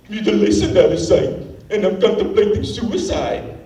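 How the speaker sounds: lightly sung line in a put-on Jamaican accent; mock Jamaican accent. Comic mocking a rock singer's Jamaican accent. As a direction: imitate the singer's Jamaican lilt, half-hearted. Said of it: "weird Jamaican accent you're doing".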